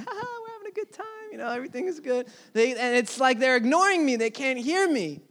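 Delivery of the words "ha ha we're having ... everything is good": high, quick and breathy, soft; mocking carefree laughter. Friends in the passing car laughing and ignoring him, high and airy. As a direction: toss it off like careless friends laughing. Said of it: "And they're laughing".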